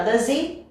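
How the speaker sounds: DOES HE as "duh-ZEE"; DOES HE linked. Shown as a demonstration. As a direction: run DOES into HE with no H. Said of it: "aqui vai virar".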